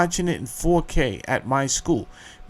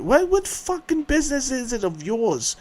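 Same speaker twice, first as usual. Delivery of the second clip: indignant; 10 semitones higher, 4 dB quieter than usual. Real, not acted. Ranting at job interviewers.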